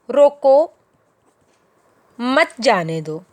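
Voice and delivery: "रोको" alone, long pause, then "मत जाने दो"; pause after रोको. Shown as a demonstration. Teacher demonstrating one sense of the line, pause after "रोको". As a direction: break firmly after "रोको", keep "मत जाने दो" together. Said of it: "इस लाइन को टू वे में".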